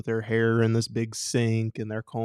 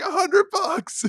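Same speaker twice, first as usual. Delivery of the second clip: high, slow, thrilled yell; excited kid shout; 5 dB louder, slower than usual. Retold or imitated. Kid yelling back to curious park kids. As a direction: crow about it.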